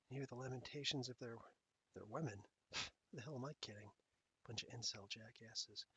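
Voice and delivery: hushed whispered villain musing; whispering. Real, not acted. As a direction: whisper it to yourself. Whispering to himself before noticing live.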